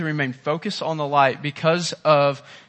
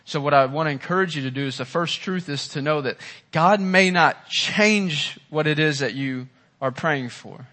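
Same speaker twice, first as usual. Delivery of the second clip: normal talking voice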